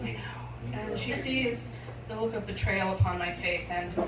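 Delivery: usual speaking voice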